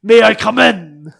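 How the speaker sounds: loud, rough call through a door. Acted. Officer knocking at the door, rough loud voice. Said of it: "you hear a rough voice saying".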